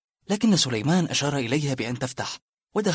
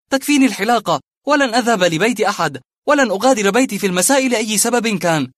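raised, hard-edged refusals; bitter refusal; 7 semitones higher, 8 dB louder than usual. Acted. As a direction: slam every door shut.

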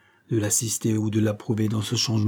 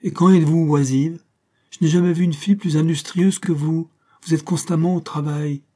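higher, louder fond protest; exclaiming; 5 semitones higher, 3 dB louder than usual. Acted. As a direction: cry out in affectionate disbelief. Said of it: "s'écria Shirley".

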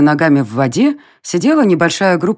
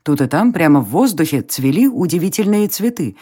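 plain reading voice

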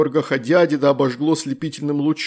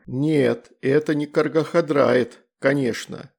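ordinary delivery

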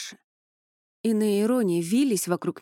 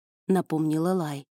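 ordinary delivery, nothing marked

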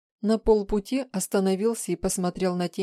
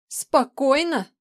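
high, loud, slow echo; outraged yell; 8 semitones higher, 7 dB louder, slower than usual. A narrator's reading. Woman turning on him, yelling in outrage. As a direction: throw the word back, yelling in disbelief. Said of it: "проорала тень".

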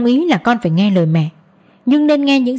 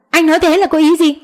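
higher, louder, sharply confronting; angry demand; 8 semitones higher, 4 dB louder than usual. Acted. Wife confronts her husband on the phone, angrily demanding. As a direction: challenge him, voice rising hot.

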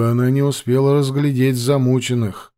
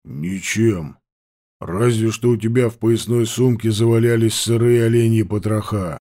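annoyed gruff voice; 2 semitones lower than usual. Acted. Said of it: "с досадой поглядел".

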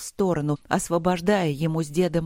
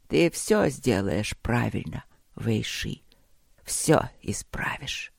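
dying emperor, tender; 2 semitones lower than usual. Acted. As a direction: reassure a grandson with failing breath. Dying emperor comforts his grandson.

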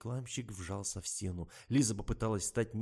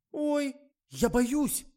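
very high, loud, slow frightened squeal; scared squeal; 9 dB louder, much slower than usual. A narrator's reading. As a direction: shriek in fright. Actress scared by the goat.

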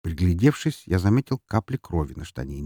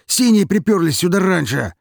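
urgent shout; 10 semitones higher, 5 dB louder than usual. Acted. Shouts police arrived.

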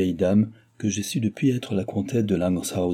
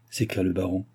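ordinary delivery

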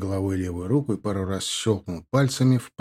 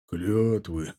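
scornful snort; 3 semitones lower, 5 dB quieter than usual. Acted. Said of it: "фыркнул Индгар".

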